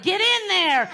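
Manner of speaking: excited cheer. Pretend. Future self cheering dog on.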